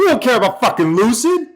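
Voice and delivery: dismissive outburst. Real, not acted. Streamer shouting down the Lucid report, irritated dismissal.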